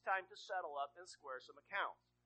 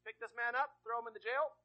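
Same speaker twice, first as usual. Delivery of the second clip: louder, higher commands; ordering guards; 5 semitones higher, 10 dB louder than usual. Acted. Ordering guards to jail man.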